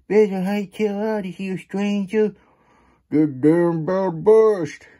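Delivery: high, loud frantic warning; panicked townsfolk. Acted. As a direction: yell it like fleeing folks.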